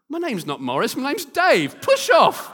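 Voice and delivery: rising to a loud, high push off; building from flat to a loud snap; irritated brush-off. Retold or imitated. Stranger Dave telling the friend to push off, building loud.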